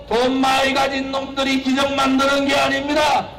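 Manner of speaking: usual speaking voice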